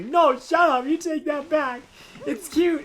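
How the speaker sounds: arguing with himself in a squeaky voice; mock indignant other self. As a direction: snap back in a high offended voice. Arguing with own statement.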